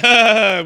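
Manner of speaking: loud, high spoken fake laugh; mock laugh. Podcaster retelling her reply to Tony, mock laughing. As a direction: imitate a put-on social laugh.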